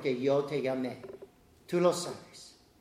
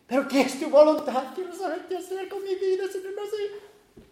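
voz de joven rogando, más aguda; plegaria del joven confundido; 14 semitones higher, 5 dB louder than usual. Acted. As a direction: ruega como un joven perdido que no sabe qué hacer. Imita oración de joven. (voz aguda de joven que suplica a Dios)

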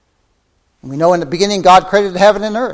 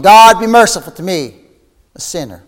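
raised plea that drops away on the last words; crying out; 5 dB louder than usual. Acted. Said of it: "Cry out like the publican".

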